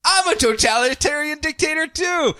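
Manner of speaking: gleeful mock excitement. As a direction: gush like a new best friend. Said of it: "Just like".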